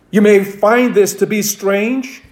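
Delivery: normal talking voice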